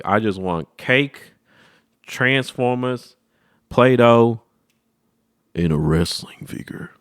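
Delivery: slow, drawn-out listing; slow wish list. Retold or imitated. Kid self listing birthday wishes, slow. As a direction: savor each item slowly.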